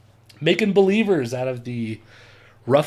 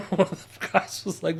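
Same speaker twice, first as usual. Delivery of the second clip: ordinary delivery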